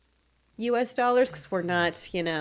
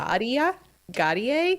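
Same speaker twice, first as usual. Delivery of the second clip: hesitant questioning rise on Gadia; asking Gadia as a guess, rising at the end; unsure; 5 semitones higher, 4 dB louder, slower than usual. Shown as a demonstration. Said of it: "you have to say it with conviction".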